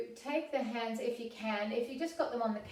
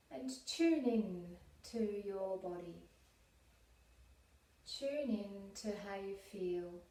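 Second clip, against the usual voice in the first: calm, unhurried meditation voice with long pauses; guided meditation; 4 dB quieter than usual. Real, not acted. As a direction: guide them gently inward, leaving space to breathe.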